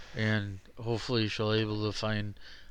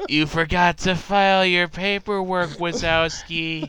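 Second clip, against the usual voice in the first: Roz impression; 7 semitones higher, 9 dB louder than usual. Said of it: "That sounds like Roz from Monsters, Inc".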